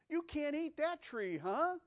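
high, loud mocking serpent voice; deceiver's taunt. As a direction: tempt her with a loud, high sneer. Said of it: "He wants you to think of sin of things you can't do".

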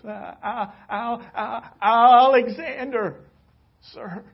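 put-on the young soldier stammers before the angry king; stammering fear. A narrator's reading. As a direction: stumble over the name in terror. Terrified soldier stammers his name.

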